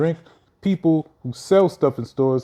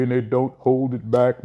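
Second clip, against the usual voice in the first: ordinary delivery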